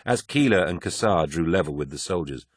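usual speaking voice

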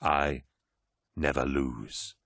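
low, slow, weighty declaration; grave certainty. Acted. Horus confirms it to four Astartes, low and deliberate. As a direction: drop the pitch and space out the words.

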